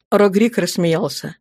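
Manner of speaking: plain reading voice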